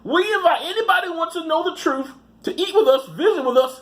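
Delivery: mock Missouri accent, half laughing. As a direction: keep the rancher's high Missouri voice. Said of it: "good Missouri accent".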